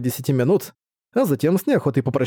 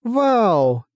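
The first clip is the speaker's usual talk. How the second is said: put-on high, bright child's wow; delighted little girl; 8 semitones higher than usual.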